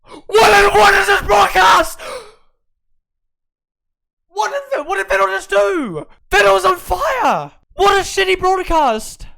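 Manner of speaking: high, loud disbelieving yells at the broadcast; outraged shout. Real, not acted. Viewer yelling at the shitty broadcast, outraged shout. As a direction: yell at the TV in real outrage. Broadcast cuts away from Vettel.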